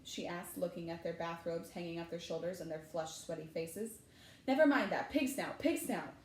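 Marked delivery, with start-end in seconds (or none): 4.5-6.1 mildly breathless urgency, starting high and loud; panting. A narrator's reading. Harry to the portrait after fleeing, panting. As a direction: hurry the password out as if winded. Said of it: "panted Harry".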